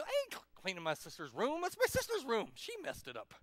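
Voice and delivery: indignant whine, jumping high then dropping; protesting kid. Acted. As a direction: object like a put-upon sibling. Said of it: "Will you help your sister".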